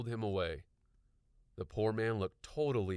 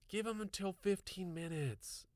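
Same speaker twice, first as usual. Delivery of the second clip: insisting; 12 semitones higher than usual. A narrator's reading. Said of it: "April insisted".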